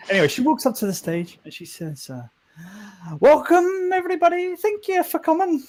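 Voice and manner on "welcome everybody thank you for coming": high-pitched, loud put-on character voice; game character's welcome speech. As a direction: greet the crowd in a high, lilting voice. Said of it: "what does she sound like?".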